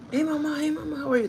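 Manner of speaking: high-pitched mock-flirty greeting; imitating coworker. Pushy coworker greeting the woman bagger, imitated. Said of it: "That's the way he talks".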